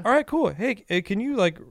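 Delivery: accommodating phone voice. Retold or imitated. Asking a caller for a proposal, high and accommodating. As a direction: pitch it up to let the caller down gently. Acting out polite reply to caller.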